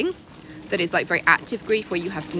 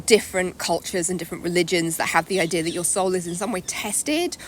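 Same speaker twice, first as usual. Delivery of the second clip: ordinary delivery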